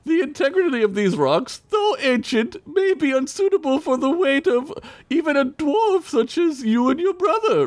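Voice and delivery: put-on high prim British robot voice, British accent; a fussy little British robot; adorable British accent. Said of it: "in an adorable British accent".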